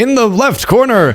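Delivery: high, booming mock fight announcer; boxing ring announcer. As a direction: hype the crowd like a ring announcer.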